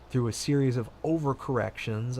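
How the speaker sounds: normal talking voice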